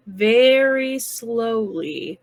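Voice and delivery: very slow, stretched-out words; drawn out. Acted. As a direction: stretch each word to show the careful creeping. Drawn out to match slow action.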